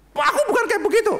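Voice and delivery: shrill high wife throwing blame back; indignant wife. Acted. Wife blaming husband for child's faith, high and indignant. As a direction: squeal it up high, offended.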